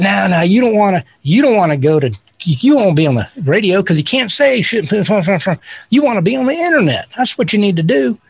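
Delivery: imitated Texan adviser, Texas accent. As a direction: talk him out of it in a Texas twang. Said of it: "You do a really good Texas accent".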